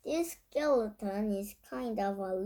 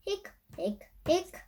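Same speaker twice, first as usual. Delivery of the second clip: high little hiccups; hiccup sounds; 6 semitones higher than usual. A narrator's reading. Narrator voicing the skeleton's hiccups, high and light. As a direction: squeak out each hic like a hiccup.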